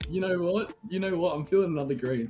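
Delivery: cocky defiance. Retold or imitated. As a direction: brush them off, feeling lucky. Cheeky reply to stop.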